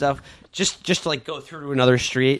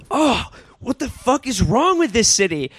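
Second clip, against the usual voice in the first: frustrated outburst; 8 semitones higher than usual. Retold or imitated. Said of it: "he just goes".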